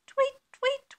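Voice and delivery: very high little chirps; birdsong. A narrator's reading. Birds starting to sing at dawn, high tweeting.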